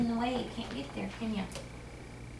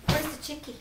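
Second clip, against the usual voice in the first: ordinary delivery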